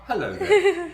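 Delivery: squeaky high laughing hello; high silly hello. Pretend.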